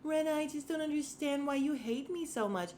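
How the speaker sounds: high, pleading mock whine; whiny Sutton. Mocking Sutton pleading with Rinna, high whiny voice. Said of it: "Sutton is just so".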